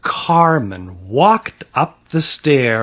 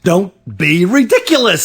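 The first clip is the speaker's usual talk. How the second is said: dismissive scolding; 6 semitones higher, 4 dB louder than usual. A narrator's reading. Father scoffs at child's alarm.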